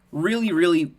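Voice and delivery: loud, emphatic insistence; passionate. Real, not acted. Streamer driving home a point about skill, passionately. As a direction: hit each word with passion. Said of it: "I'm very passionate about that".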